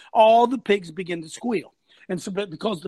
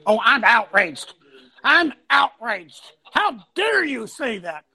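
slow, loud mock indignation; mock outrage; 3 dB louder, slower than usual. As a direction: draw out a hammed-up outrage. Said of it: "See, outrage is just a word".

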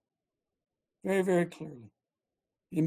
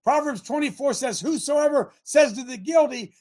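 loud, fervent scripture preaching; 3 semitones higher, 3 dB louder than usual. Acted. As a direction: preach it from the pulpit with fire. Preaching scripture at full force.

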